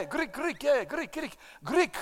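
mock Greek accent. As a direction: toy with the word Greek in a jokey Greek accent. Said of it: "Why am I talking like this for".

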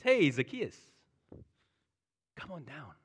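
hey called out, then easy; friendly hail. Retold or imitated. Jesus greeting Zacchaeus in the tree like a friend. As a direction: call up to an old friend. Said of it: "as though he's a good friend".

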